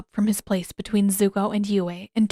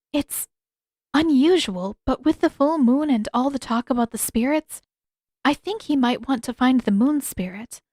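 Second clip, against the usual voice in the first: lightly voiced gentle princess; 5 semitones higher, 3 dB louder than usual. A narrator's reading. Yue telling friends the Admiral's plan, lightly higher. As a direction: speak as a careful young princess. Yue's voice in story.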